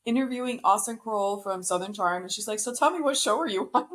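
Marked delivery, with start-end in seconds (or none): 2.6-3.9 higher, blunt mock interviewer question; lightly imitating a blunt host, pitched up. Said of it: "but she's so blunt".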